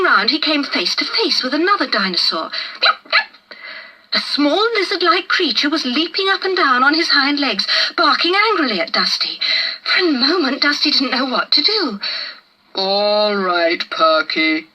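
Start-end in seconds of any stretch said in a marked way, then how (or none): 2.8-3.6 high yappy yip-yip; sharp yapping bark. A narrator's reading. Lizard dinosaur barking at the boy, yapping high. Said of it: "A sharp bark". 12.7-14.7 lower, unhurried big dinosaur voice; calm gentle giant. A narrator's reading. Brontosaurus hushing the lizard, lightly voiced giant. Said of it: "Bron's voice silenced the lizard".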